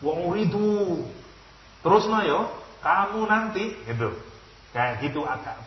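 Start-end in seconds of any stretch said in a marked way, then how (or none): 0.0-3.8 nada tinggi dan keras, mengancam. Shown as a demonstration. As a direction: baca seperti ancaman kepada orang Quraisy. (Allah mengancam Quraisy, suara tinggi dan keras)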